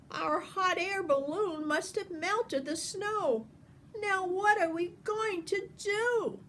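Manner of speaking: a flustered singer in a panic; high worried soloist. A narrator's reading. Soloist trapped in the house, high and worried.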